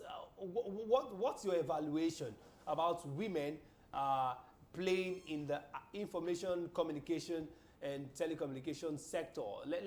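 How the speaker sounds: usual speaking voice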